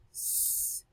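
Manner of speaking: "S" as a quiet unvoiced hiss. Shown as a demonstration. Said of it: "that sound is s".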